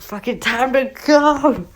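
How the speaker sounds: high, urgent mock shout; calling out. Hero fleeing with the snack, calling out.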